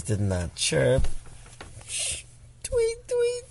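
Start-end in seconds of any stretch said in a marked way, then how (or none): 2.6-3.5 high chirpy tweet tweet; playful high birdsong imitation; bird tweet. Parent acts bird sounds in story.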